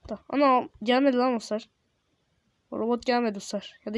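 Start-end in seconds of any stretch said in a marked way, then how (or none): none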